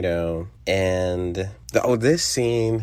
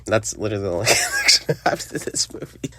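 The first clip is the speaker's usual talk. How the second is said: quick words overtaken by laughter; laughing; faster than usual. Real, not acted. Podcaster amused by their only connection to this movie, laughing. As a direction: break into real laughter mid-sentence.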